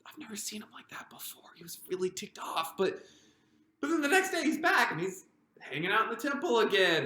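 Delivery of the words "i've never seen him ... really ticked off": a hushed secretive whisper; whispering to Peter about the angry teacher. Acted. Confiding about Jesus's anger.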